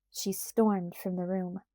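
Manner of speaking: plain reading voice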